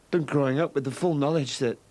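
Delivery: ordinary delivery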